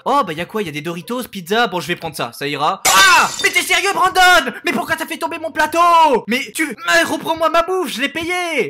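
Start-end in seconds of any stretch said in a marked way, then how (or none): 2.9-8.7 outraged yell. Acted. Bully knocks over his tray.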